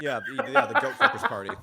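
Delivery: high, loud laughing. Real, not acted. Cracking up at a friend's joke, laughing.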